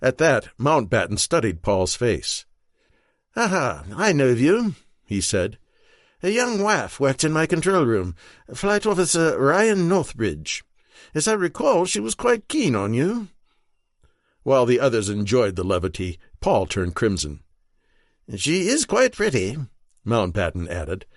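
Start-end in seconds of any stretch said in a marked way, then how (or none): 3.4-4.7 higher, bright aristocratic voice; lightly voiced upper-class officer; Mountbatten voice. A narrator's reading. Mountbatten speaks. 6.2-13.3 higher, teasing aristocrat; playful upper-class officer; Mountbatten voice. A narrator's reading. 18.4-19.6 higher, teasing aristocrat; playful upper-class officer; Mountbatten voice. A narrator's reading.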